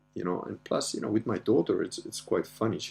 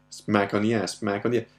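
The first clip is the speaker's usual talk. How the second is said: ordinary delivery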